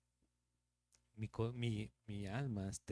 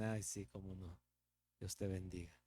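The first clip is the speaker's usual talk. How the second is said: softer, lower sarcastic mutter behind their back; mock the blessing under your breath; sarcastic aside; 4 semitones lower, 7 dB quieter than usual. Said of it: "por detrás tal vez nos dicen". (a espaldas, bendición sarcástica en voz baja)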